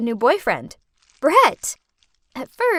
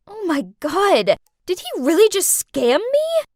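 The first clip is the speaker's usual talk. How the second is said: louder, higher shocked outburst; 6 semitones higher, 5 dB louder than usual. Acted. A girl realizes her boyfriend scammed her, shocked. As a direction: realize you've been scammed.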